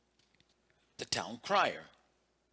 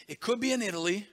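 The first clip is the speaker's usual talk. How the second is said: ordinary delivery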